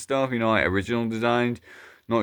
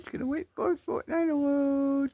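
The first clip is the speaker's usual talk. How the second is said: squeaky high-pitched voice, muted; very high squeak voice; 6 dB quieter than usual. Acted. A tiny squeaking toy waits for the game.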